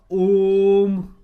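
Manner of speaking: ओम held long and slow, the vowel stretched well past normal; drawn-out om. Shown as a demonstration. Teacher showing students a prolonged vowel, stretched slow. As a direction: stretch ओम slowly like a chant. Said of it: "लंबा बोलना पड़ता है".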